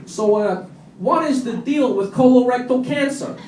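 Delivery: high, loud stand-up comic patter; hard-sell a bad joke; funeral stand-up. Gatecrasher doing cheesy stand-up at a funeral, high and loud.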